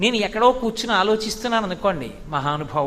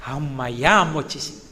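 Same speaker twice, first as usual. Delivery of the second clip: lower, softer sigh of relief; relieved child; 8 semitones lower, 4 dB quieter than usual. Acted. Child in his mother's lap, relieved she returned, soft sigh. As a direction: breathe it out like a comforted child.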